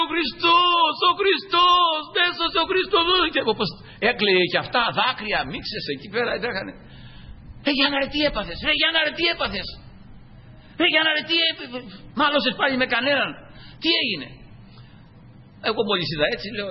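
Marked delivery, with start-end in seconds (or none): 0.0-3.0 high, loud tearful cry of joy; crying shout. Retold or imitated. Father crying out after conversion. 7.7-14.3 high, worried repeated question; alarmed friend. Retold or imitated. Old comrade asks what happened.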